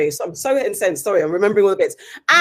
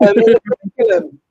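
laughing; 14 semitones higher, 5 dB louder, faster than usual. Real, not acted. Laughs mid word.

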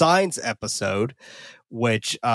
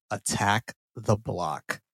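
slow, low and softer, word by word; dramatic title reveal; 4 semitones lower, 5 dB quieter, much slower than usual. Pretend. Host reveals tonight's film to everyone, slow dramatic announcement. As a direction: let the title land slowly and quietly.

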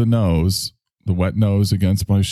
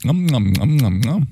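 mock eating noises; 5 semitones higher than usual. Mock eating noises while drinking.

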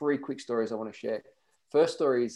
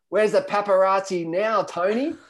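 teasing call; 7 semitones higher, 7 dB louder than usual. Retold or imitated. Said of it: "And Dave goes".

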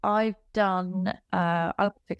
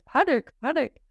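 higher, louder, clipped official alarm voice; austere British voice; 7 semitones higher, 3 dB louder than usual. Retold or imitated. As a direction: announce it like a stern British machine. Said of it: "in a very austere British voice".

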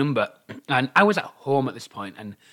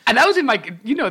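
normal talking voice

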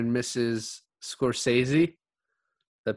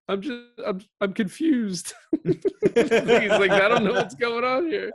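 higher, laughing impression of the crying dad; mock confused dad; 11 semitones higher, 4 dB louder, slower than usual. Retelling the dad crying at the wedding, laughing high voice. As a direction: whine it through laughter.

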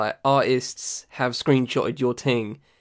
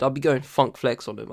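ordinary delivery

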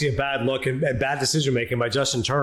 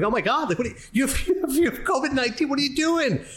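mock yell, much higher, with a laugh inside; retold shout of disbelief; 8 semitones higher than usual. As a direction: shout it as the shocked reaction you had. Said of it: "And I'm like".

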